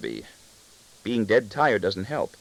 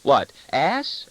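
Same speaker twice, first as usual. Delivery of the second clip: put-on higher playful girl's question; frisky; 6 semitones higher, 4 dB louder than usual. A narrator's reading. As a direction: join the teasing, voice lifted. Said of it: "joining in friskily".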